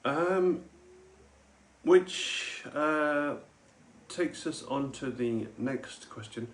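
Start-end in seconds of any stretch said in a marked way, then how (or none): none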